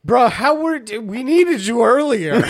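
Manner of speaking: high, incredulous complaint; mock exasperation. Player complaining wizard came too late, exasperated.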